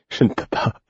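breathless, voiceless pant; panting through pain, begging to stop. Acted. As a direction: gasp the words out in pain. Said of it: "allaitai-je".

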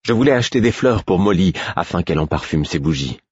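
ordinary delivery

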